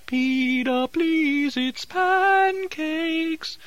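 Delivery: high, drawn-out calling mother. Retold or imitated. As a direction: call him down for breakfast. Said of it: "His mother kept calling up".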